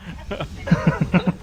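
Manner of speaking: loud laugh. Real, not acted. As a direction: burst out laughing. Laughs at the mispronunciation.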